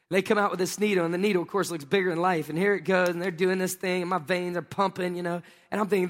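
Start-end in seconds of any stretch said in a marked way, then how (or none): none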